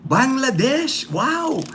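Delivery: delighted surprise at a new viewer's country; surprised. Real, not acted. Host reading a first Bangladesh viewer's chat, surprised.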